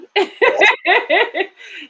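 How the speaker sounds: high, loud laugh. Real, not acted. Host delighted after the reading, loud laugh.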